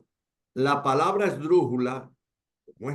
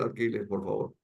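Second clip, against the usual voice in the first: normal talking voice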